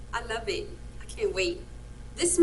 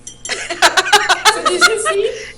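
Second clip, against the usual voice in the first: excited; 7 semitones higher, 10 dB louder, much slower than usual. Real, not acted. Excited over food.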